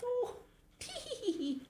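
shrill-pitched, soft tee-hee of a funny little woman; light, squeaky-high storybook titter; high tittering giggle. A narrator's reading. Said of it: "laughed the funny little woman".

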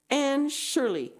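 loud, high, slow stern demand; a teacher scolding slowly and hard; teacher confronting a pupil in class; angry. A narrator's reading. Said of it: "he said angrily".

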